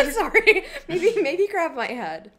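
high, giggly apology; giggling. Real, not acted. Friend practising the head hold, giggling. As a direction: talk through giggles.

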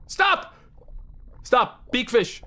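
sudden high shout; exasperated yell. Real, not acted. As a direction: cry out to halt it. Yells at the game.

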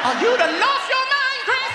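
high, drawn-out scolding; slow, soaring motherly outrage; outraged mother. Acted. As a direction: stretch it out in high-pitched disbelief. Outraged mother answers son's back talk.

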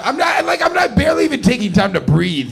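high, loud breathless rush; frantic binge rant. Retold or imitated. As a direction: rattle it off like you can't stop to breathe. Comic acting out frantic binge.

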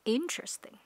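teacher model word; S in the middle. Shown as a demonstration. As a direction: bring out the middle S of interesting. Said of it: "Có âm sờ giữa".

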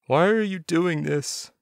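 a lightly voiced sob, pleading with his killer; sobbing plea. A narrator's reading. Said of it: "Doug was sobbing so hard".

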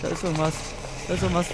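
usual speaking voice

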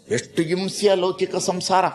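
usual speaking voice

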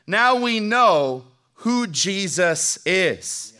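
very slow, each word weighted; slow emphatic declaration. Real, not acted. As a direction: drive it home word by word. Preacher landing the point.

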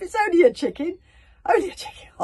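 very loud, high shout; relieved yell. Acted. Bergamo, seeing only a chicken, yells in relief.

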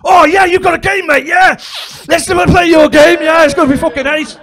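high, loud, gushing enthusiasm; overexcited holiday rep. Retold or imitated. Animator gushing at the dad's game offer. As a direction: animator thrilled to play.

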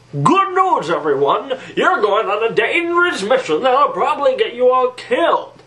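high, swooping cheerful announcement voice; cartoon boss. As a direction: announce doom as if it were great news. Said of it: "do an impression video of all my".

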